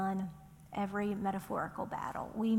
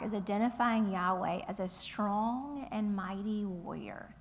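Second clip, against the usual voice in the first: ordinary talk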